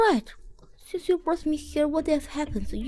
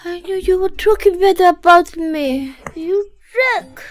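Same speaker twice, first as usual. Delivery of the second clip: put-on loud, higher-pitched drunken accusation; drunken outburst; 5 semitones higher, 8 dB louder than usual. Drunk woman catches host badmouthing her, loud indignation.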